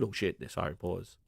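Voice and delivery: usual speaking voice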